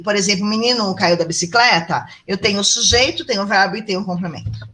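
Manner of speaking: ordinary talk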